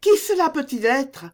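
high, anxious question; worried. A narrator's reading. Louise gets up on hearing footsteps, worried. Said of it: "en se levant tout inquiète".

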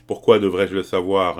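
loud, surprised interruption; forceful, astonished question; genuinely surprised. A narrator's reading. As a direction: cut in with a startled question. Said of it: "vraiment surpris".

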